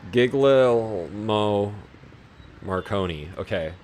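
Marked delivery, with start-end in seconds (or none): 0.1-1.7 Gigliomo sounded out very slowly; struggling pronunciation. Real, not acted. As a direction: drag out Gigliomo syllable by syllable, unsure. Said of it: "I can say the last name, but the first one".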